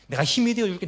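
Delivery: "힘이" punched out loud and high; fervent promise. Acted. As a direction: proclaim "내가 힘이 되어 줄게" with force. Comforter promising help.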